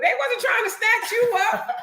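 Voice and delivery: high and laughing through the words. Real, not acted. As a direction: tease him while cracking up. Laughing at co-host's complaint.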